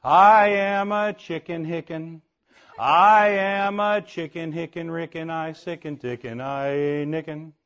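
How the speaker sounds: slow, careful nonsense chant; real slow. Shown as a demonstration. Preacher demonstrates the chicken song slowly for kids. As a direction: spell out each silly syllable slowly. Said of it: "I'll go real slow".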